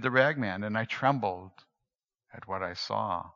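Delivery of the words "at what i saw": ordinary delivery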